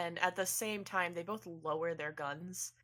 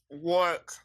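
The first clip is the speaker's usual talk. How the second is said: speaker voice; 8 dB louder than usual. Acted. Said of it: "A little speaker pops out and goes".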